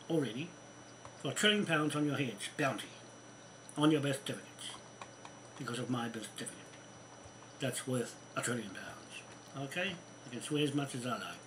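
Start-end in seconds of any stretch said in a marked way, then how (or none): 5.6-9.6 hushed whisper. Real, not acted. Muttered boast in angry rant.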